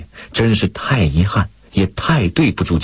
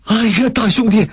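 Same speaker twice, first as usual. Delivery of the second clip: high-pitched, overjoyed exclamation; delighted surprise. A narrator's reading. Country woman seeing her ticket held out, delighted surprise. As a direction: cry out in happy astonishment. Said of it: "大嫂霎时惊喜".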